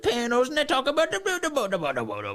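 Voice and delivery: host mocking Carville's rambling as gibberish; mock Swedish Chef. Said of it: "he's doing the Swedish Chef routine".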